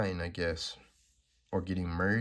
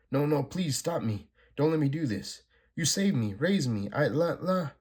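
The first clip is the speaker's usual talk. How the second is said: higher, lightly acted desperate plea; mild pleading; 7 semitones higher than usual. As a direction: beg to be stopped, a touch frightened. Lila pleading against possession.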